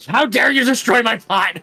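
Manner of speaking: high, loud indignant villager; outraged shopkeeper yelling; angry NPC voice. Retold or imitated. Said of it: "this guy was like".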